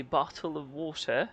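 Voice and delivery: "bottle of water" with every T pronounced; crisp British T. Shown as a demonstration. Showing two ways to say it, "bottle of water" with clear Ts. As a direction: sound out both Ts in "bottle of water". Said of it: "you can say it two ways".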